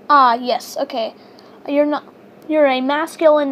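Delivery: ordinary talk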